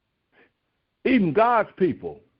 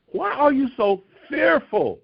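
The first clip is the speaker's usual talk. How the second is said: scolding question; 8 semitones higher than usual. Retold or imitated. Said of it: "this is the way he's addressing them".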